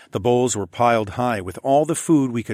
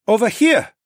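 loud high call across the room; 12 semitones higher, 7 dB louder than usual. Acted. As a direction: call out to the family. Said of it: "Sun Hee called".

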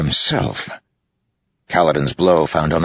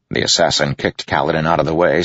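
plain reading voice

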